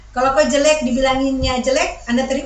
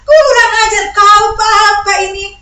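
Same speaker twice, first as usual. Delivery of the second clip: outraged scream; 10 semitones higher, 13 dB louder than usual. Acted. Wife erupts at husband mid-confession, furious shouting. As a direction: explode at him in full-voiced outrage. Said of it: "kau langsung teriak".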